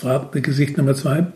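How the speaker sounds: plain reading voice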